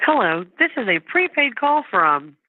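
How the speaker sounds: tinny phone line; on a prison phone. Real, not acted. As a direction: sound like a recorded jail call. Recorded prison phone call played.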